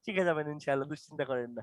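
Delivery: low, soft reassurance; reassuring. Real, not acted. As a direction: gently tell a student not to worry. Teacher comforts student after wrong answer.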